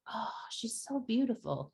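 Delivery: softly gushing imitation of mom; a light, airy impression of an adoring mom; admiring mom. Mom seeing the friend's face, gushing softly. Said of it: "Every time my mom sees your face".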